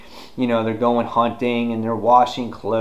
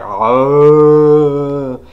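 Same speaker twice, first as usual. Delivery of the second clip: loud, long mock zombie groan; zombie moan; 4 semitones higher, 9 dB louder than usual. Zombie comes up to the hunters, loud drawn-out moan.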